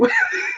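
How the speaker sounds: sudden high-pitched laugh; high giggle. Real, not acted. Host admitting quibbles with her website, high giggle. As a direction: break into a squeaky giggle mid-sentence.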